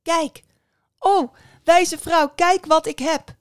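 high, loud, triumphant cry; excited exclamation. A narrator's reading. Young woman showing the witch her hair, excited exclamation. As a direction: burst in proudly, calling out. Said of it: "riep ze uit".

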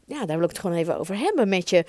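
ordinary talk

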